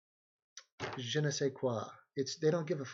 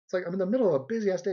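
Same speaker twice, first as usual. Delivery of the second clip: normal talking voice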